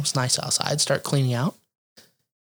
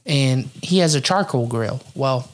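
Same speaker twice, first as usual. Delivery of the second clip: ordinary talk, nothing marked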